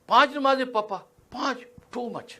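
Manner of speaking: put-on high, whiny kid's protest; child complaining. Child whining to papa about five prayers. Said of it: "یہ بچوں کو کہتے ہیں".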